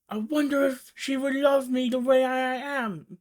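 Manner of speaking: high, fragile, pleading Merrick impression; John Merrick voice. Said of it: "I'm sorry to do this voice".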